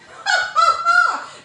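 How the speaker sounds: loud, high laugh; real burst of laughter. Real, not acted. Laughs at the tray slip.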